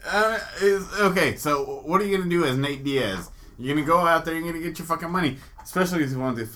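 ordinary talk, nothing marked

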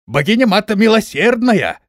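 loud, high cry to the goddess; desperate appeal. A narrator's reading. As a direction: call out for divine mercy. General cornered, appeals to goddess. (громкая мольба высоким голосом)

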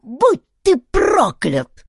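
high, loud, slow squeal; little chief's enraged squawk; squeaked curse. A narrator's reading. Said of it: "пропищал он".

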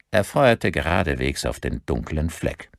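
ordinary delivery, nothing marked

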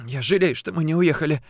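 a touch of crying in the words; tearful regret. A narrator's reading. Woman regrets they didn't leave, tearful. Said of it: "плакала Варя".

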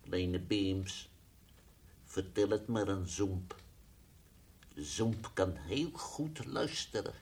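soft, low coaxing; gentle coaxing. Acted. Zoemp softly coaxing little Bims to tell. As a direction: coax the child gently.

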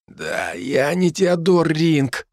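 slow, hoarse croak from the floor. A narrator's reading. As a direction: croak it out weakly, lying collapsed. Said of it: "прохрипел я с пола".

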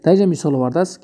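ordinary talk, nothing marked

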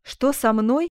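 loud, ardent declaration of love; joyful bold exclamation. A narrator's reading. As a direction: proclaim love in a surge of joy. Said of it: "обретая в приливе радости ту смелость". (громко, радостно и смело)

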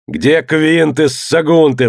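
drawn-out, high-pitched roar; angry roar, each word stretched out; roaring demand. A narrator's reading. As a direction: bellow slowly for the culprits. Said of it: "взревел он".

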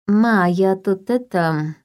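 hesitant. Acted. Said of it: "замялась я".

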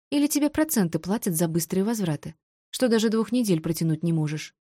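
plain reading voice

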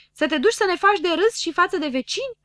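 high, loud scandalised question; outraged roar. A narrator's reading. Friends fearing shame before neighbours, loudly outraged. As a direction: throw it at the poet loudly. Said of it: "răcniră cu toții".

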